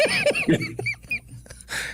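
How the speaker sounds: squealing burst of laughter; high-pitched helpless laugh. Real, not acted. Laughs after bathtub bit.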